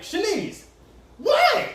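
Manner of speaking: high mock yell of a name, laughing. Dad yelling for Shanice from another room, high and laughing. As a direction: call her name, then squeak what. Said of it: "and I'm like".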